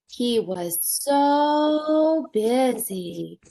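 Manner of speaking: very slow, stretching the word so; slow refrain. Acted. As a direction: draw the refrain out for the kids. Storybook refrain, drawn out.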